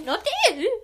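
imitated accent slip, high and loud; voice crack. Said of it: "that's a voice crack".

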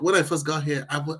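ordinary delivery

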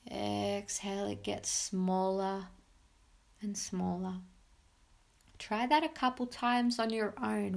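soft spa whisper. Acted. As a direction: guide them gently into calm. Leads a guided meditation.

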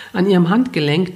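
ordinary delivery, nothing marked

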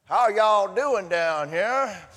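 slow, loud Southern drawl greeting. Pretend. Pastor imagines a Chinese visitor greeting Georgians, in a Southern drawl. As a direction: stretch it out in a broad Southern drawl. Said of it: "would speak with a southern drawl".

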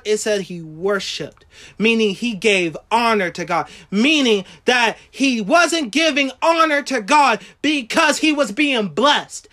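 ordinary delivery

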